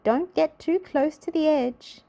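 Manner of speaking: a worried friend calling out a warning. A narrator's reading. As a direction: warn him from a distance. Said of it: "called Little Miss Fun".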